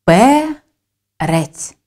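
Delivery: word pulled apart syllable by syllable; Перець broken slowly in two with a clear gap; split into syllables. Shown as a demonstration. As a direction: say Перець in two separate syllables, пе, then рець. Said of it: "Вимовимо його для поділу на склади".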